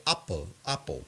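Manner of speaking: put-on apple as ahpple, a light Sicilian accent; a mild imitation of a Sicilian grandfather saying apple as uhpple. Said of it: "that's not a great impression".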